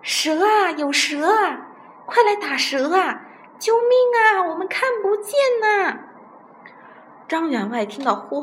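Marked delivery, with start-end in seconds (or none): 0.0-6.0 high, loud cries of panic; frightened shouting. A narrator's reading. Blind men yelling for rescue from a snake, terrified shouting. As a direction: scream for help, voice climbing high. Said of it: "吓得也跟着大嚷".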